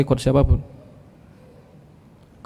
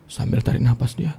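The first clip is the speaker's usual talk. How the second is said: ordinary delivery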